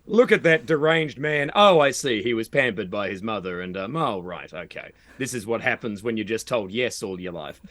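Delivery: judge him coolly, dropping to an undertone. Retold or imitated. Onlookers judging a pampered man, low unsympathetic undertone. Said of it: "which is a bit less sympathetic".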